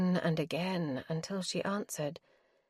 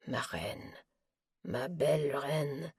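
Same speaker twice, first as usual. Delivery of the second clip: lower, much softer tender murmur; murmuring soothing; 4 semitones lower, 7 dB quieter than usual. Acted. Hemp soothing Doro in his arms, soft murmur. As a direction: murmur endearments close to her ear. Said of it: "his voice murmuring soothing words".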